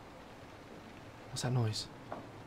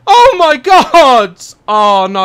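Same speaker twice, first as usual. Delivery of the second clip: high, loud, slow shaken exclaim; rattled by the scare; real alarm; frightened cry; 12 semitones higher, 17 dB louder, much slower than usual. Real, not acted. Still shaken after scare.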